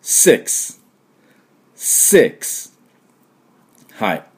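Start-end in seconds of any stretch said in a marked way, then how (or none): 0.0-2.7 six with a sharp S and voiceless k-s ending; model "six". Shown as a demonstration. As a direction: model six for repeating, hissing both ends. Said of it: "私の後でいただきましょう". (鋭く息を漏らすお手本のsix)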